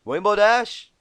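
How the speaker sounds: loud, high, irritated call out; annoyed call. Acted. Annoyed Applejack calls out to Rainbow behind the wall. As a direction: call her name, fed up. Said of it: "began in an annoyed voice".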